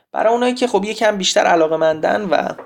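ordinary talk

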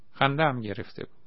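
usual speaking voice

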